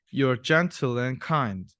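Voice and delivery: ordinary delivery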